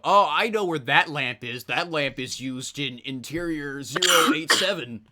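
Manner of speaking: loud, slow know-it-all; nerdy know-it-all voice. Retold or imitated. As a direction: show off the trivia proudly. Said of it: "could go".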